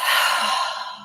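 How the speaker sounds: long audible sigh; deep breath out. Shown as a demonstration. Reader leading viewers in a deep breath, long sigh. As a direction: let out a long, airy exhale. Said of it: "take a deep breath".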